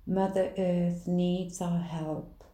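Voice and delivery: ordinary talk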